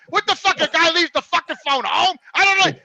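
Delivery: loud, high outraged ranting; furious rant. Retold or imitated. Ranting to George's son about the forgotten phone, loud and furious. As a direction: vent the outrage full force.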